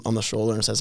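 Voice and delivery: normal talking voice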